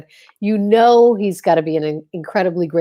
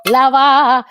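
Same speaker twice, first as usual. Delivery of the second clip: suggestive purr; 5 semitones higher, 6 dB louder than usual. Pretend. Playful innuendo echo.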